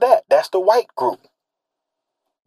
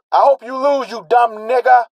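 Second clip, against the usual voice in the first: hostile fan jeering, voice higher; mock heckler; 6 semitones higher than usual. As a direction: sneer like a crowd heckler. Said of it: "People snarling at him".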